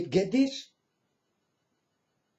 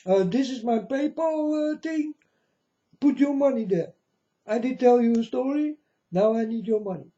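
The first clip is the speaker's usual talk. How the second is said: high, wheedling mock preacher; mocking beggar preacher; 7 semitones higher than usual. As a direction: send up a preacher begging for money.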